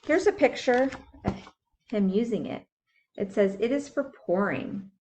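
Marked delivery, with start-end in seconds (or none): none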